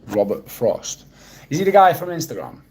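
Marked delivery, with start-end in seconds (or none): 1.5-2.6 high and loud, a sudden puzzled question; puzzled surprise. Real, not acted. Streamer guessing who the poet is, surprised and high. Confused guess about Robert Frost.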